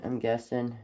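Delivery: ordinary delivery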